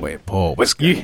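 slow echo of the bartender; hearty innkeeper. Retold or imitated. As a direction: repeat it slowly in a bartender voice. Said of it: "repeats the bartender's line".

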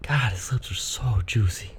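breathy whispered flirting. Pretend. Friend teasing a buddy in a mock-flirty whisper. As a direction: whisper it like a secret crush.

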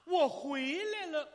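high, loud, drawn-out call to mother; long theatrical homecoming cry. Acted. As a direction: holler it slowly toward the house. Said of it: "喊了一声".

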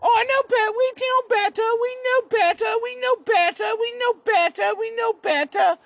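high, sing-song smug chant; snooty British. Retold or imitated. The British lecturing the world, mock snooty voice. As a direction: chant it like a know-it-all. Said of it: "the British were like".

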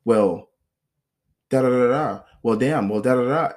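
a touch of a retold shouting match; mock yelling. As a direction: play her yelling at herself. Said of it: "She'll go".

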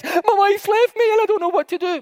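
high-pitched retold wail; mock tearful outburst. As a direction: blurt it out as if bursting into tears. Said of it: "I burst into tears and says".

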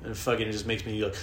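usual speaking voice

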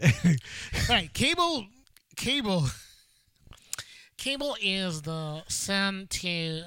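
slow, announcer-like nerd voice; nerdy voice. Pretend. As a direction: put on a gimmicky nerd narration. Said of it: "nerdy voice or what?".

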